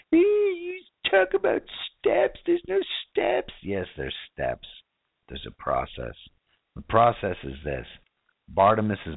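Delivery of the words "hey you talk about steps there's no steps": very high squeaky mock skeptic voice; high-pitched mocking doubter. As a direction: sneer it in a high put-on voice.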